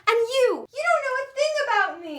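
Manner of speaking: high, loud accusing outburst; angry shout. Acted. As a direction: turn on her in fury. Lashes out at mother.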